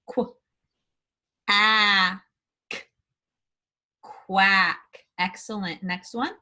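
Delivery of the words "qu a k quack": sounds said apart, then quack whole; blending sounds. Shown as a demonstration. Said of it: "blend these words".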